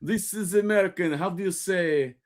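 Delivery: hammed-up fake Russian, searching for words; mock movie-Russian accent; hack Russian accent. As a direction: play a cliché Russian groping for an idiom. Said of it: "It's always that accent".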